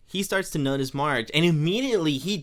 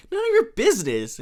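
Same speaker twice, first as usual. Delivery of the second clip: much higher, louder snippy reply; offended Marge; 14 semitones higher, 5 dB louder than usual. Retold or imitated. Marge refusing the shoe question, high and indignant. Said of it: "And then she goes".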